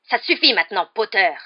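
loud, high-pitched outburst of rage; furious shout. A narrator's reading. Rogue beside himself, confronting Potter, shouting. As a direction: shout him down angrily. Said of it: "cria-t-il".

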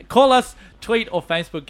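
high, loud and laughing; loud excited plug. Real, not acted. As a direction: belt out the call-in plea. Host echoing call-in plea loudly.

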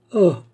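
high, loud cry of dread; short startled exclamation; frightened "ah". A narrator's reading. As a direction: recoil with a sharp "ah". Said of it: "avec un geste de répulsion et d'effroi".

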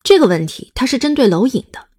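ordinary talk

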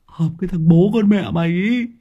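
high, stammering panicky voice, far above the narrator; stammering in terror. Acted. Đoàn Béo stammers to his friend after seeing the ghost photo, panicked high voice. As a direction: stutter out the words in fright. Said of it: "Thằng Đoàn Béo run cầm cập".

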